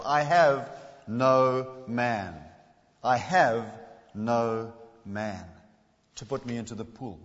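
"I have no man" drawn out very slowly, each word weighted; slow, stressed "I have ... no man". Shown as a demonstration. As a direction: lean on "have" and "no man" with long pauses. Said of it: "I do want to stress".

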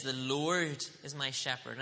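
slow, with "my" stressed in "The Lord is my shepherd"; slow it down and lean into "my"; emphatic. Shown as a demonstration. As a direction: pastor shows the emphasis to the church, slow and firm. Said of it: "Look at how he says this".

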